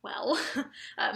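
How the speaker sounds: breathy nervous giggle; nervous whispered laugh. Real, not acted. Cornered by the boss, a whispered nervous laugh.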